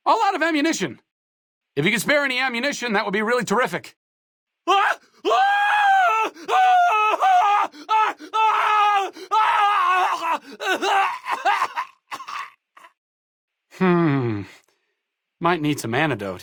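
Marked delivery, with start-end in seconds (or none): 4.7-11.8 screams of pain. Acted. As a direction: scream as if on fire. Burning or dying screams.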